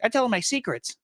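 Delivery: lightly voiced spooky kid; creepy child voice. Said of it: "he'd be like".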